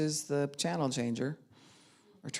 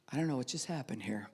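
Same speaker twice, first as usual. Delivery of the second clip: fast, soft, dazed whisper; stunned whisper; 3 semitones lower, 8 dB quieter, faster than usual. Retold or imitated. Husband just home, overwhelmed by ten things, whispering. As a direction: mumble it, overwhelmed.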